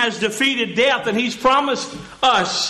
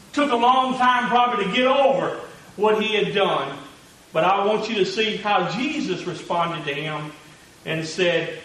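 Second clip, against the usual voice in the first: ordinary delivery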